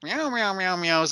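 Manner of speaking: high mock meows; cat meowing. Imitating the cat nurse handing out tests with high meows.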